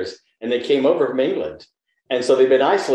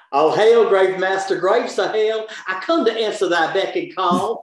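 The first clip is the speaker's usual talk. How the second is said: put-on Southern accent; 6 semitones higher than usual. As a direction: declaim grandly with a thick Southern accent. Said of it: "Your accent".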